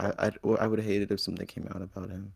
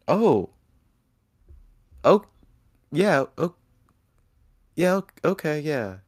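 loud, high startled reaction; loud surprise; 7 semitones higher, 10 dB louder than usual. Real, not acted. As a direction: react loudly, caught off guard. Reacting to best friend wish.

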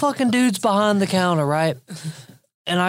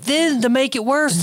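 usual speaking voice

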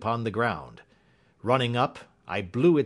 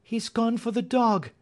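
alarmed cry; 13 semitones higher than usual. Acted. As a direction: cry out the warning, pitched high. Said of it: "cried Miss Hunter".

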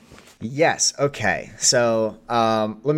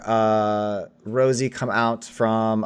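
normal talking voice

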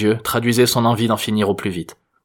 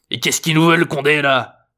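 put-on cantankerous old geezer, pitched up; hoarse old man; 6 semitones higher, 4 dB louder than usual. A narrator's reading. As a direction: grumble high and husky at the cops. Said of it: "une voix enrouée".

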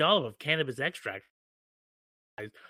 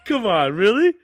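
surprised; 4 semitones higher, 13 dB louder than usual. Real, not acted. Realizing his mistake, laughing.

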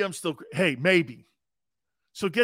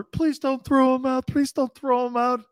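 high mock-pleading grandma voice; pleading grandmother; 9 semitones higher, 4 dB louder than usual. Grandmother begging the coach not to expel him, high pleading. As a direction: beg in a higher voice, lightly hammed.

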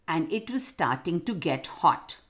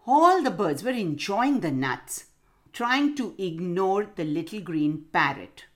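ordinary delivery, nothing marked

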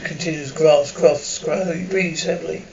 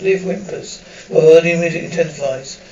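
usual speaking voice

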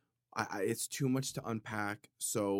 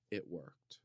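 sheepish aside; 4 semitones lower, 9 dB quieter than usual. Real, not acted. Confessing the scare worked.

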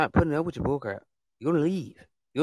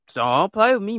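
loud warning; 9 semitones higher, 8 dB louder than usual. Real, not acted. Host warning trolls.

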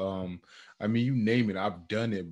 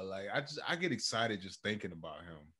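soft tender; 2 semitones lower, 7 dB quieter than usual. Real, not acted. Tender about his son.

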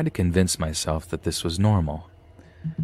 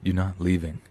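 serious voice; 3 dB quieter than usual. A narrator's reading. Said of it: "a dead stare and serious voice".

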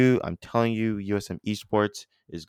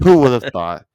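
loud repeat; 4 semitones higher, 13 dB louder than usual. Acted. Repeats line for effect.

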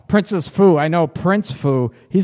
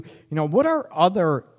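ordinary delivery

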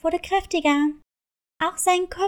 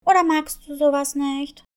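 ordinary delivery

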